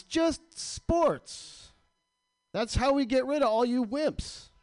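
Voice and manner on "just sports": drawn out. Real, not acted. Comic rant on sports.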